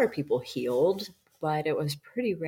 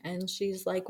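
normal talking voice

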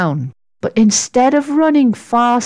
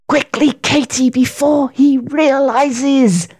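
higher, urgent aside, slowing at the end; added quietly; 4 semitones higher than usual. A narrator's reading. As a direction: hurry a friend along under cover. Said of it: "she added quietly".

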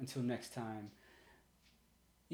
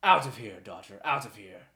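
shouted "Out of here", much louder, then falling back; furious dismissal; 9 dB louder than usual. Acted. Father ordering his daughter out, shouting. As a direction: drive her out with a yell.

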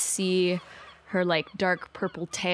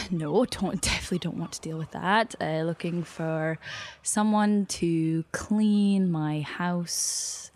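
ordinary talk

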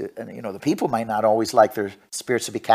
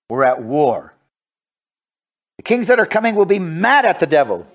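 emphatic, declamatory war cry from the pulpit; rally the congregation with conviction; forceful preaching; 7 semitones higher, 5 dB louder than usual. Real, not acted. Preacher declares war with the devil, forceful preaching.

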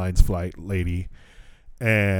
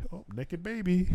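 high and soft, amused coo; 9 semitones higher, 14 dB quieter than usual. Real, not acted. Noticing a naked baby, high soft cooing. Baby appears mid-story.

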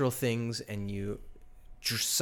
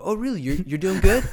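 much higher, louder, incredulous "really?"; mock surprised disbelief; 8 semitones higher, 9 dB louder, faster than usual. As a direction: feign shock that they're doing good. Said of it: "I go".

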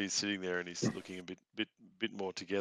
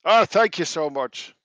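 loud, high gasp of relief; relieved captive; 10 semitones higher, 13 dB louder, slower than usual. Acted. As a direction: blurt it out, finally free. Gagged NPC freed, gasping thanks.

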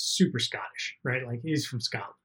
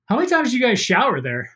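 1 incredulous question; 9 semitones higher, 4 dB louder, faster than usual. Retold or imitated. Asking driver, retold in disbelief.

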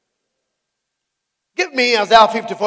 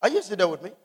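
ordinary talk

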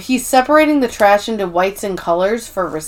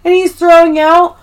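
high, loud imitation of a hype man; hyped-up sales pitch; 7 semitones higher, 5 dB louder than usual. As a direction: announce a big arrival like an overexcited promoter. Ryan's hyped-up marketing pitch acted out.

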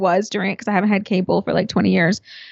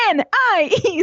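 high, mock angry letters; imitating a furious spelling; 7 semitones higher than usual. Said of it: "she spells out the name".

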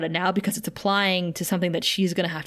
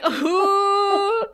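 high squeaky laughing who; laughing disbelief; 11 semitones higher, 3 dB louder than usual. Real, not acted. Laughing at Riley's challenge while recapping. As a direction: crack up mid-question. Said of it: "And I was like".

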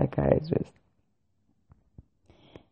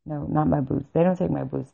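ordinary talk

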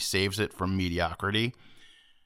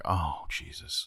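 low, slow, breath-heavy sigh of words; weary exasperation; 6 semitones lower, 9 dB quieter, slower than usual. Retold or imitated. Narrator recalls watching the family sanitize seats, weary exasperation. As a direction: let it sink out quietly, fed up.

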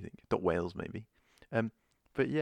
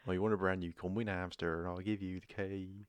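West Country accent; 4 semitones lower, 5 dB quieter than usual. Shown as a demonstration. Co-host shows off a second accent, mock West Country. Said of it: "I've got, like, West Country".

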